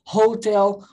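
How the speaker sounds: "Hotel" with a breathed H at the front; sounded English H. Shown as a demonstration. Teacher giving another English example, "Hotel" with sounded H. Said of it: "O H tem som de R no inglês".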